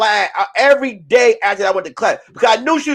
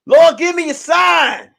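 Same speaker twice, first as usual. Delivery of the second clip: mock pleading prayer; 6 semitones higher, 4 dB louder than usual. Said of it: "I'm praying".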